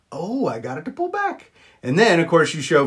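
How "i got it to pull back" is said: ordinary talk, nothing marked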